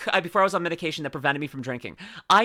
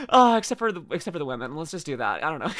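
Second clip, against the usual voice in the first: ordinary delivery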